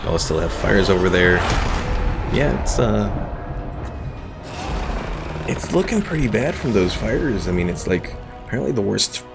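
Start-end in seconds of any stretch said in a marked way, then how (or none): none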